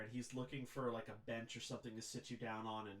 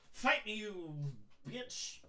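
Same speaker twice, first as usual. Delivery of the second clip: put-on loud, higher drunken taunt; belligerent drunk; 9 semitones higher, 6 dB louder, much slower than usual. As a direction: pick a fight like a sloppy drunk. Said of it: "belligerent drunk state".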